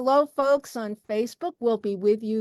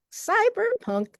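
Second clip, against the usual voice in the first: CYBERPUNK pitched way up high; high-pitched repeat; 12 semitones higher, slower than usual. Real, not acted. Host listing poetry genres, high-pitched "cyberpunk". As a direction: lift "cyberpunk" far above your usual pitch.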